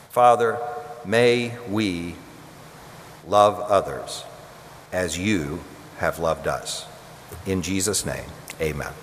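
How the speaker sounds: soft, sinking closing prayer. Real, not acted. Pastor closing the sermon in quiet prayer. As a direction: pray gently over the people.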